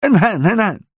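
quick high nein nein nein; hasty. A narrator's reading. Innkeeper hastily backing down, quick and high. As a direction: rush to backpedal. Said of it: "erwiderte der Wirt hastig".

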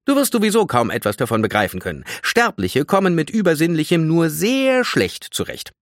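mildly superior explanation, rising in pitch; slightly condescending. A narrator's reading. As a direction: talk down to a mere mortal. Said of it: "überheblichen Tonfall an". (leicht überheblich und belehrend)

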